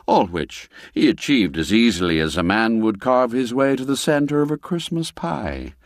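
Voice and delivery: ordinary delivery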